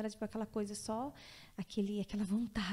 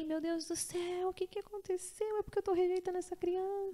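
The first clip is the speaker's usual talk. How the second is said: mock panic; 9 semitones higher than usual. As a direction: replay the fright, high and dragged out. Retold panic after falling.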